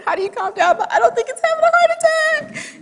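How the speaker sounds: incredulous, rising to a squeak; exasperated disbelief. Retold or imitated. Wife answering the doctor's calm down, in high-pitched disbelief. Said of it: "And I'm thinking".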